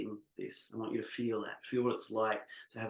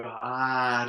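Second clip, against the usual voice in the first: louder open ah; pouring-out ah; 5 dB louder than usual. Shown as a demonstration. Leader pouring voice toward imagined clients, open ah. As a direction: send an ah out toward listeners. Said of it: "pour out of your voice".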